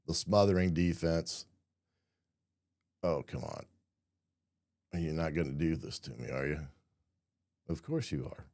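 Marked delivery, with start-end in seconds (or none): 3.0-8.5 exasperated muttering. Real, not acted. Annoyed at video software.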